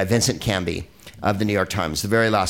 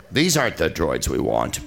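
lower, wooden extra's line reading; Stormtrooper voice; 7 semitones lower than usual. Pretend. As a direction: read it like a stiff stormtrooper. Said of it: "Stormtrooper line readings".

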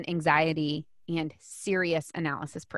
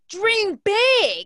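a sudden much higher, louder shout of the slogan; mock yelled slogan; 13 semitones higher, 9 dB louder than usual. Speaker mocking self-help gurus with a loud shout. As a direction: bellow it like a pushy motivational coach. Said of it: "Yelling at the person now".

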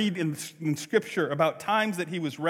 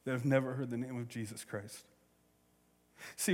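ordinary delivery

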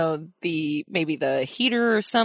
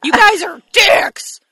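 mock yell; 11 semitones higher, 8 dB louder, slower than usual. Yelling at sponsor.

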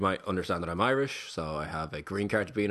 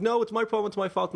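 much higher mock-distraught voice; retold hysterical protest; 12 semitones higher than usual. Woman insisting the problem is hers, mock hysterical. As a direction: imitate her upset insistence, without real sobs. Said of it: "she's, like, hysterically crying".

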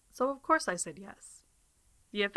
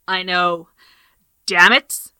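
frustrated outburst; 13 dB louder, slower than usual. Real, not acted. Frustrated at lost recording.

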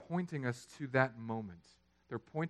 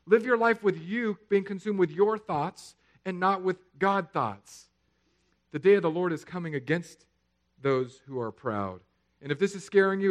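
loud, raised-pitch sermon warning; preacher in full voice; forceful preaching; 6 semitones higher, 8 dB louder than usual. Real, not acted. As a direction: drive the warning home to the congregation.

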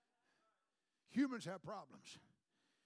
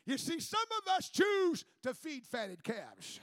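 ordinary talk, nothing marked

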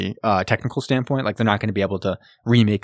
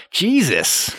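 mock exasperated; 7 semitones higher than usual. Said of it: "And he'd be like".

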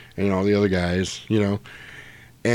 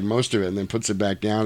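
ordinary talk, nothing marked

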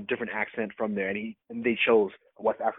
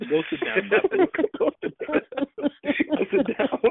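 laughing mock dismissal; slower than usual. Real, not acted. Mocking M'Baku after choke-out.

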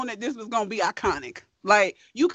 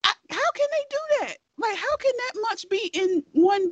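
amazed; 7 semitones higher, 3 dB quieter than usual. Real, not acted. Marvelling at the couple.

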